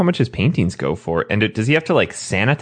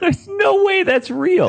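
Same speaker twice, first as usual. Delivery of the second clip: laughing disbelief; slower than usual. Real, not acted.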